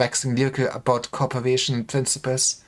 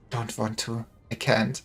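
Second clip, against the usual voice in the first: broken refusal; 3 semitones lower, 6 dB quieter than usual. Acted.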